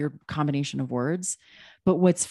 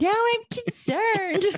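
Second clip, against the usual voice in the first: much higher, slow fretting voice; mock concerned partner; slower than usual. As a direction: fuss like a worried partner. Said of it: "My concerned partner".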